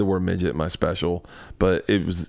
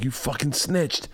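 soft, slow mock accusation; hushed, drawn-out accusation; accusing daughter; 3 semitones higher, 6 dB quieter, slower than usual. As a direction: imitate a kid who has caught you out, under your breath. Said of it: "eyes light up like".

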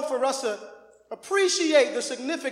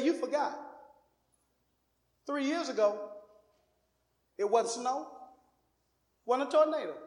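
soft-spoken dad; 2 semitones lower, 7 dB quieter than usual. Retold or imitated. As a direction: calmly set his son straight, softly. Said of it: "He's soft".

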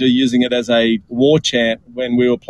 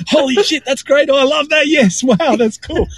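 much higher, gushing over the ads; amazed gushing; 12 semitones higher than usual. Retold or imitated. Watching Nike ads in the car, high excited gushing. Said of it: "going to YouTube now going".

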